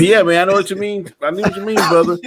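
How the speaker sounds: lightly voiced friendly stranger agreeing; friendly guy.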